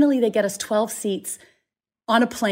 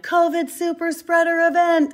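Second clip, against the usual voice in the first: mock yell; 8 semitones higher, 3 dB louder than usual. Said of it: "everyone's yelling".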